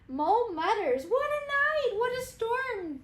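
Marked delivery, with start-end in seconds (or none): 1.1-3.0 high, lively little bird voice; twittering bird voice. Acted. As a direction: twitter it like a wren. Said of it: "twitters wren".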